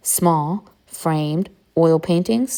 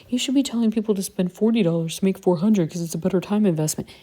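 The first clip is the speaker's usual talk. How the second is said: lightly put-on voice of an online critic; mock a commenter's objection, lightly put on; hammed-up pantomime voice; 3 semitones higher, faster than usual. Imitating commenters on spending forty dollars, mild pantomime voice. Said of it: "pantomime voice".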